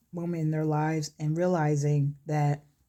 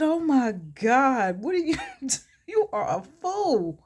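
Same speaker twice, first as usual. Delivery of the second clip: imitated hard laughter; 7 semitones higher, 4 dB louder than usual. Wife laughing at her husband's clowning, imitated amused disbelief. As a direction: tease through laughter, acting her out. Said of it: "She is laughing so hard".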